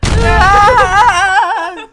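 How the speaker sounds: loud high pained yell; pained scream. Real, not acted. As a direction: scream from the burn. Burning spice, shout.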